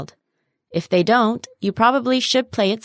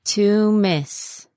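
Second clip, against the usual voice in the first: "To miss" with both words stretched; slow word model. Shown as a demonstration. Teacher modelling "To miss" for learners, slowly. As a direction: draw out "To miss" carefully.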